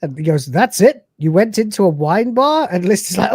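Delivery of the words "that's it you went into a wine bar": mock disbelief. Character reacting in disbelief.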